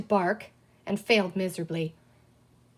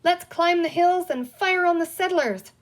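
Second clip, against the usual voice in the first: high, loud gleeful pirate cry; a pirate joining the game, delighted; 9 semitones higher, 6 dB louder than usual. A narrator's reading. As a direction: shout it out with delight. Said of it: "cried Captain Hook in delight".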